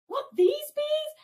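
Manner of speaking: high squeaky puzzled call; mock oblivious friend. Vortex not understanding the warning, high puzzled mock call. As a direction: play the clueless friend calling back.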